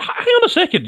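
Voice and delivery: ordinary talk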